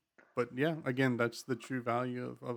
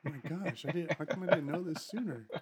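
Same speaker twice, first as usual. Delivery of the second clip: higher voice, laughing; mock excited user; 5 semitones higher than usual. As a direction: play an excited convert.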